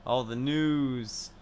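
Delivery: slow, mumbled words; mumbling. Acted. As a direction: mumble it lazily. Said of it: "sorry for my mumbling".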